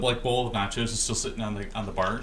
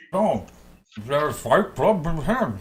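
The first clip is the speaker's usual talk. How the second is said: talking through a mouthful; high, loud words round a bite of food; mouth full; 5 semitones higher, 5 dB louder, slower than usual. Acted. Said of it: "While his mouth is full".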